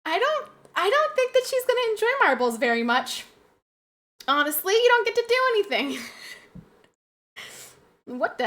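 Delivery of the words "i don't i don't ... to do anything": playful doubt. Real, not acted. Teasing doubt about marbles game.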